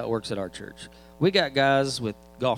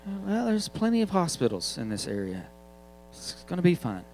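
mildly higher, shrugging parent reply; breezy parent; 6 semitones higher than usual. Retold or imitated. Parent brushes off the kid's fear, lightly breezy. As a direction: dismiss the worry lightly.

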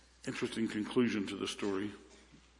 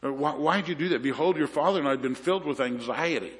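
higher, louder reproach from a frantic mom; a worried mother's raised, accusing voice; frustrated accusation; 4 semitones higher, 5 dB louder than usual. Retold or imitated. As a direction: scold the lost son, pitch up and push. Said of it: "It's an accusation".

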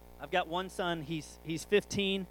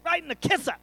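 playful mock threat; 10 semitones higher than usual. Dad tells wife what he'd give his son, mock threat. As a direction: pitch leaping up high, mock menace.

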